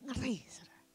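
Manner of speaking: a hushed whisper of horror; preacher whispering to the congregation how frightening it is; whispered dread. Real, not acted. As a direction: breathe it out like a secret. Preacher dwelling on something chilling.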